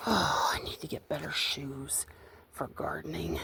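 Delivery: soft whisper to herself. Real, not acted. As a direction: breathe it as an aside. Says it to herself while gardening.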